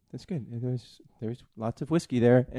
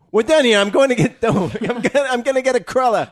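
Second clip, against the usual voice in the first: excited; 12 semitones higher, 9 dB louder than usual. Real, not acted. Loud, laughing mock exit.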